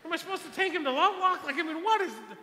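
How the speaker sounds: very high, loud, quick bafflement; hammed-up exasperated questioning, near laughing; retold exasperation. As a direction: blurt it out in comic disbelief. Retells own baffled exasperation.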